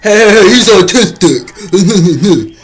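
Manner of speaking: mocking bystanders. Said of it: "they got people".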